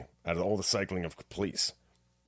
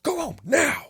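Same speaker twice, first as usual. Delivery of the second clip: ordered home; 6 dB louder, slower than usual. Retold or imitated. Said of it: "He's like".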